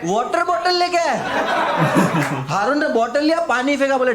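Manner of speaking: high, loud, laughing through the words; can't hold back laughter telling it; giggling disbelief; laughing rant. Real, not acted.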